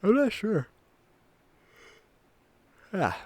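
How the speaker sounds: speaking through a yawn; words stretched by a yawn. Real, not acted. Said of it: "I'm yawning now".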